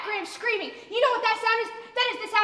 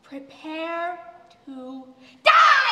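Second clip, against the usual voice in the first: mock threat; 4 semitones lower, 4 dB louder, much slower than usual. Mock threat over dinner.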